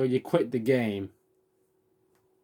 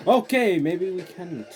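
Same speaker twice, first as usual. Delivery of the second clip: alarmed backpedal; 7 semitones higher, 4 dB louder, slower than usual. Real, not acted. Retreat in alarm.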